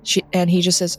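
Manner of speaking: ordinary talk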